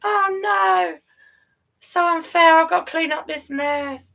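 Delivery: put-on high, loud whiny kid complaining; mock child whine. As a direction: whine it like a sulky child told to tidy up. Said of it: "crying on the floor like they do".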